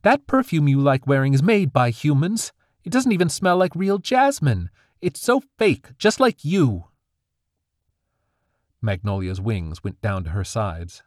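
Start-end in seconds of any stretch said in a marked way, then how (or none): 0.0-6.9 higher, louder angry accusation; high, charged with anger. A narrator's reading. Daughter accusing her mother, high and angry. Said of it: "voice still high and charged with anger".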